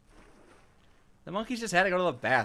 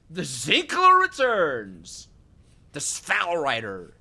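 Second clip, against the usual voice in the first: big drawn-out hammed-up announcer call; slow, loud, overblown heralding; mock grand announcement; 7 dB louder, much slower than usual. Streamer heralding the ore that returns, mock grand announcement.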